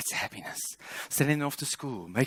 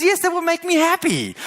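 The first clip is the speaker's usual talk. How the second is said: much higher, louder mock wife; pitched-up imitation of a demanding wife; wife's voice; 7 dB louder than usual. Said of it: "And she says".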